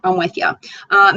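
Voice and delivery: normal talking voice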